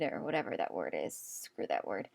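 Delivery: half-whispered throwaway grumble; muttered aside. Real, not acted. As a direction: grumble it to yourself under your breath.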